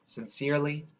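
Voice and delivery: plain reading voice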